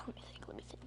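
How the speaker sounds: whispered, thinking aloud; whispering to himself. Real, not acted. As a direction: whisper it as you search your memory.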